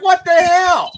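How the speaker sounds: incredulous outburst. Retold or imitated. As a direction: blurt it out, floored by the creepy comment. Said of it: "I'm like".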